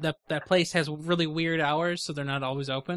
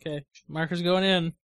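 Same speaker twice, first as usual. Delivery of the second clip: ordinary talk, nothing marked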